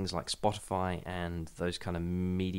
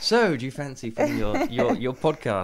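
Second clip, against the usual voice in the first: higher, louder mock pitch from a radio executive; mock BBC producer; 6 semitones higher, 8 dB louder than usual. Podcaster imitating the BBC offering a deal, playful mock pitch. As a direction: toss off a keen offer like a broadcaster. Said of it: "the B B C come along and they're like".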